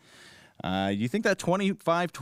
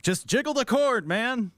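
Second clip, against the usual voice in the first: put-on old running joke; 9 semitones higher than usual. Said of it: "old Ben Joravsky Show joke".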